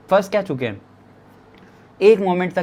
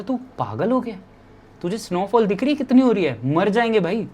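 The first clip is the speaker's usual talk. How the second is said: retold incredulous protest, higher pitched; mock alarm; 5 semitones higher than usual. Ruben arguing with him about walking into snowfall, retold incredulous protest. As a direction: raise the pitch like an exasperated friend. Friend's alarmed reply to going out in snow.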